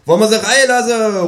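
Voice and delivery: calling into the flat. Retold or imitated. Narrator calls into the flat to the kangaroo, high loud call. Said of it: "rufe ich in die Wohnung hinein".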